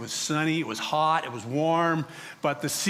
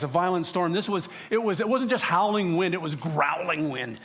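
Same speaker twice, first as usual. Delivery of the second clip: ordinary talk, nothing marked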